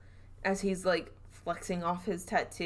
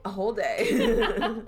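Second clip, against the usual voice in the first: laughing; 4 semitones higher, 6 dB louder, slower than usual. Real, not acted. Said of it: "laughing confirmation".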